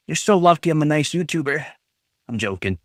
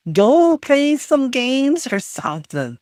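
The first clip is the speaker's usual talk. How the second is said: higher and slower, pleading in exasperation; exasperated plea; 8 semitones higher than usual. Real, not acted. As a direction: beg them to leave, drawn out and fed up. Exasperated at spamming viewers.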